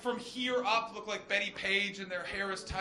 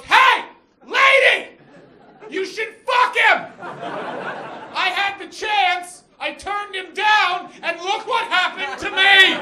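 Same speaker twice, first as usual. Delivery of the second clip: put-on high, loud shouted plea to the girl; old friend with a walker yelling at the girl; old friend's shouted advice; 11 semitones higher, 14 dB louder, slower than usual. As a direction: bellow it like a desperate wingman. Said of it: "and goes".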